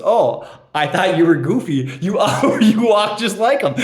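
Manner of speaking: a light hint of a sweet old lady, laughing through it; lightly voiced old woman mistaking him for Goofy, chuckling; little old woman. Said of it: "this little old woman goes".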